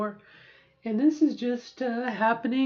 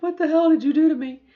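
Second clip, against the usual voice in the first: fast, louder, higher indignant yelp; mock outraged cry; 5 semitones higher, 7 dB louder, faster than usual. Skin cries out at her after electrolysis, mock outrage. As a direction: voice the skin's outrage, hammed up. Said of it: "and it's like".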